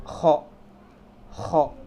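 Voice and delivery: "Kho" scraped from the throat; emphatic guttural "Kho" demo; throaty kho. Shown as a demonstration. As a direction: rasp the start of "Kho" in the throat. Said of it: "harus di tenggorokan nih ngomongnya".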